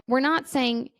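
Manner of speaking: ordinary talk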